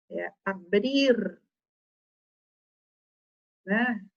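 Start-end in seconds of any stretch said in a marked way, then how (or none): 0.5-1.4 "abrir" drawn out to a full final R; final R sounded. Shown as a demonstration. Teacher correcting pupils on the verb, "abrir" with its R. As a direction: stretch "abrir" and sound the last R. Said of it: "Tem que olhar como que é o verbo".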